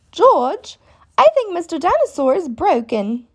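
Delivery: high little girl voice, lightly voiced; chirpy piggy girl tone; a child piglet talking to her brother; Peppa voice. A narrator's reading.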